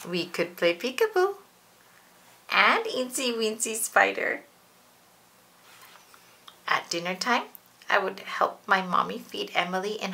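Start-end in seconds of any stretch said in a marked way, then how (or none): none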